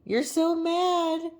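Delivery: high, slow drawn-out pet voice. Real, not acted. Owner teasing her grouchy dog, slow high baby talk.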